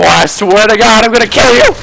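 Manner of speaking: mock threat. Friend threatening a hider who runs around, mock angry yell. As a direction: playfully threaten to kill him.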